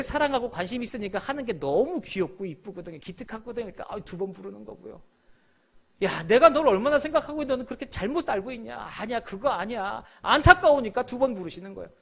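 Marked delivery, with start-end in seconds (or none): 6.0-11.1 pleading cry. Acted. Jesus pleads, hurt and caring.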